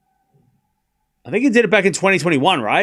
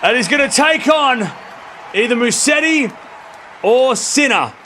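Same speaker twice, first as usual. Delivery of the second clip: excited commentary shout; 8 semitones higher than usual. Real, not acted. Streamer calling the match-winning point, building to a shout. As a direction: call the winning shot like a commentator.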